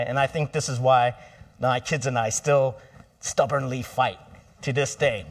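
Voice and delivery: normal talking voice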